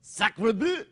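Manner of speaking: mock French. Puppet adds mock French exclamation.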